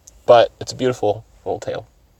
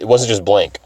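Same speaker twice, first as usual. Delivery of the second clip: usual speaking voice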